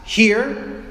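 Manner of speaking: all-caps text. Acted. Friend texting from outside the apartment, in all caps. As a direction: punch it out like a text in capitals. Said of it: "if they text you in all caps".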